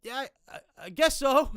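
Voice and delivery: high, halting start then loud; stammer, then call it out high; grudging holler. Retold or imitated. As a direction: imitate a flustered neighbour conceding. Neighbour grudgingly calls back.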